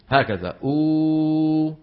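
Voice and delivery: أو held long with rounded lips; drawn-out oo. Shown as a demonstration. Teacher demonstrating the rounded oo sound. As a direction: stretch the أو slowly, lips rounded. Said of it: "والشفتين كيف بيكون شكلهم".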